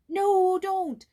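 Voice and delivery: put-on frightened boy pleading with his friend; frightened begging. A narrator's reading. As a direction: beg her not to tell, scared. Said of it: "begged Jules".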